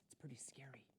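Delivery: soft hushed whisper. A narrator's reading. Narrator whispering to young listeners about fear. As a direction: whisper it like a secret. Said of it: "storyteller whisper".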